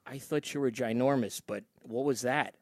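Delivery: soft, low retold question; hushed imitation of a bewildered kid; quiet puzzled son. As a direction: ask it small and confused.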